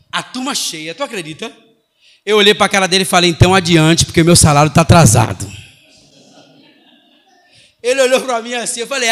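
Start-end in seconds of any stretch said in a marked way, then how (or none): 3.3-5.5 loud, forceful comeback to a cheeky pupil; retold teacher's retort, much louder. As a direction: throw it back at the student, loudly.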